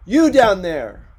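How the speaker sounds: loud, drawn-out call down from the window; crying out. A narrator's reading. Ricardo shouting down to the men in the alley, loud. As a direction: bellow it down to the alley. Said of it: "cried Ricardo out the window".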